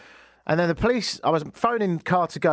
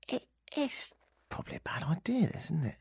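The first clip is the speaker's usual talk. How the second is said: breathy self-doubting whisper; 5 semitones higher, 10 dB quieter than usual. Retold or imitated. To himself in the car, a hushed whisper. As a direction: whisper it to yourself like a bad idea. Inner doubt heading to ikea.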